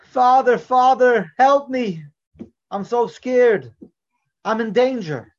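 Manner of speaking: high, loud terrified cry for father; a scared little boy calling for help; a child screaming in fright for his father; frightened child screaming. Acted. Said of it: "and he was screaming".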